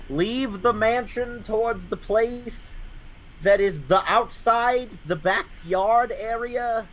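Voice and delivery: high, slow, drawn-out guide voice; slow put-on voice. Directing a friend high and very slow.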